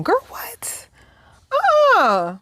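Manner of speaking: breathy high whisper of disbelief, then a squeaked refusal; shocked whisper. Real, not acted. Reacting to the creepy kid quote, a shocked high whisper. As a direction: gasp it out, horrified and high.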